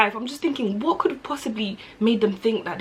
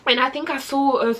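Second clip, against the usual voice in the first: usual speaking voice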